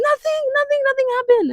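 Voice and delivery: high, fake-innocent denial. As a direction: squeak out a guilty "nothing". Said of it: "I'd be like".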